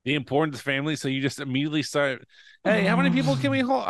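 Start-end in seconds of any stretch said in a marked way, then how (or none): 2.6-3.9 quick, high and eager. Acted. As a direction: rattle it off like an overeager booker. Pitching an eager booking call.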